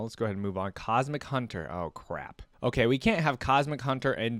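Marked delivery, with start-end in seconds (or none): none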